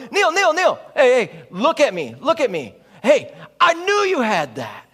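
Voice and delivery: loud, high shouting with a whoop; over-the-top hype. Retold or imitated. Teammate in center court cheering Neil, loud and high. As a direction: cheer him like a fan gone wild. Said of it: "center of the court going".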